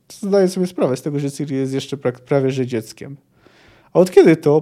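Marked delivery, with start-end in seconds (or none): 3.9-4.6 loud dismissive snort; scornful snort. Acted. Iskra scoffing at the band's chatter. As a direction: bark out the scoff. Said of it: "parsknęła znowu Iskra".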